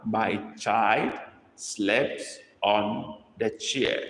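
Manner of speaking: put-on My child slept said word by word, very slow; slow dictation. Shown as a demonstration. Teacher dictating to the class, very slow and clear. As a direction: spell it out slowly for the class to copy. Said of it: "dictating a sentence to copy".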